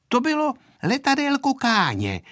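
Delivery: put-on high, politely explaining child; good little girl's polite answer; well-behaved girl. A narrator's reading. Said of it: "řekla způsobně Aneška".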